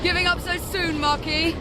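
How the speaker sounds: teasing shout. Acted. Isabeau teasing the Marquis, shouting over wind. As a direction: toss the taunt over the wind.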